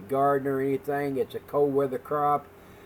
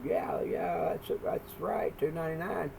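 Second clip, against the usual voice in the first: a near-whisper, higher and much softer, unvoiced; lady's hushed reply; 4 semitones higher, 7 dB quieter than usual. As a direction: lean in and half-whisper the confirmation, a touch higher. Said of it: "And she said".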